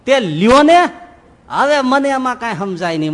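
put-on the old woman snapping to be left alone; irritated snap. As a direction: shove him off. Old woman snaps back.

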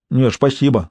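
usual speaking voice

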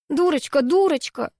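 mild mock scolding; repeating insistently. A narrator's reading. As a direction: keep chanting it fondly. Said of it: "твердила Анаит".

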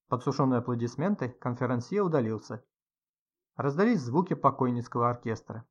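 plain reading voice

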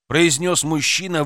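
ordinary delivery, nothing marked

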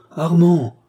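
Armand cried out high and loud in alarm; captain crying out to his freezing colonel, alarmed. Acted. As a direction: call his name in sudden fear, high and loud. Captain calls the dying colonel.

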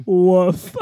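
one low mock bark; imitated dog woof. Imagining the Shih Tzu typist answering, low mock woof. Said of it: "if actually Irma's just like".

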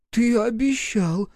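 put-on high child voice, smiling sheepishly; guilty smile. A narrator's reading. Boy reminds Мороз of his promise, smiling. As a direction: say it with a guilty grin. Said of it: "виновато улыбнулся Гришка".

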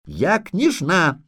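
high, drawn-out haughty insistence; indignant yelling child. A narrator's reading. Little princess asserts her rank, high and indignant. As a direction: stretch it out like an offended princess. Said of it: "хватит вопить".

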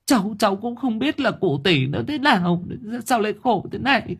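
high voice on the verge of tears; tearful. A narrator's reading. Granddaughter-in-law tells neighbors what happened, tearful. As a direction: explain while fighting back sobs. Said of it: "mếu máo".

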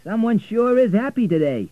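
loud, high cabbie remark; cab driver. A narrator's reading. Driver remarking on Richard's big tip, loud and high. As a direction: comment loudly, like a cabbie. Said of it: "said the driver".

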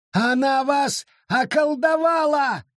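high, slow shriek. A narrator's reading. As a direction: screech the accusation. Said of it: "завизжал он".

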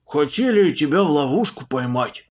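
loud, eager monk voice; eager chatter. Acted. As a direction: blurt it out gladly. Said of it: "обрадованно затараторил он".

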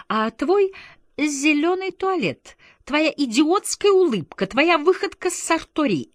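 high, mounting list of grievances; indignant counter-attack. A narrator's reading. Shérigo throws madame's faults back at her, indignantly. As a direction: fling each accusation back, pitch soaring. Husband flings counter-accusations in quarrel.

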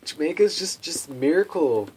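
loud, high-pitched dopey enthusiasm; mock naive wonder. Man parodies his old idea of Jamaica, mock naive wonder. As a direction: gush it big, slowing on "magical fairyland".

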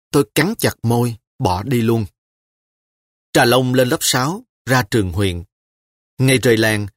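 plain reading voice

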